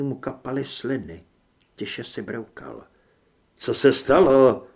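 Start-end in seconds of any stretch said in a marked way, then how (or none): 3.6-4.7 loud, urgent question; alarmed question. A narrator's reading. Doctor worried about his patient asks, alarmed and loud. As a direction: demand to know, alarmed.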